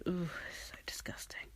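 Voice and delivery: breathy whispered ooh of disgust. Real, not acted. Grossed out by a beard, whispering in disgust. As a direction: recoil in a hushed whisper.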